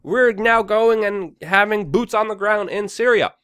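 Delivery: loud, high imitation of a public announcement; mock official announcement. Imagined official telling the public about troops, mock announcing. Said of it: "made an official announcement".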